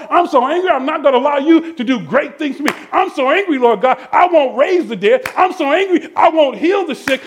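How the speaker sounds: preacher acting out stubborn anger; mock angry refusal. Angry believer refusing God's blessings, performed in heated preaching. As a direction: hammer each complaint, pitch jumping up on the refrain.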